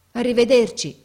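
put-on a loud, moved goodbye shouted after an old friend. A narrator's reading. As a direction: call it out to the leaving train, moved. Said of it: "gridò mio padre con voce commossa".

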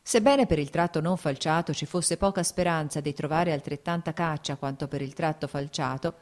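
usual speaking voice

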